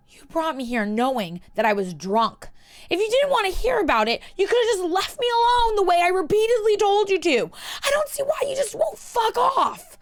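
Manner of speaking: high, loud, fast furious yell; furious shout. Acted. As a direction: scream it at him. Drunk Hermione screaming at Malfoy.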